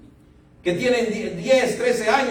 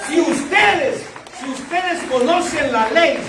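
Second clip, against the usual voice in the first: fiery preaching; 5 semitones higher than usual. Acted. Pastor urging the congregation to bear fruit, fiery preaching. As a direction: drive the point home to the congregation.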